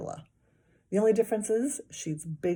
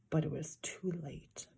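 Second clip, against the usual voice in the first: whisper; 2 semitones lower, 8 dB quieter than usual. A narrator's reading. Narrator dread.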